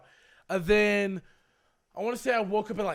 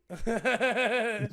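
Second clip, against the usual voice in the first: nervous laugh; 3 semitones higher than usual. Real, not acted. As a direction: laugh it off, uneasy. Laughs at the unread message.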